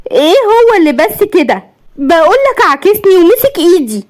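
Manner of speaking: very high, incredulous outburst; high-pitched indignant protest; outraged wife. A narrator's reading. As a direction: throw it back at him, pitch way up. Wife outraged at husband's indifference.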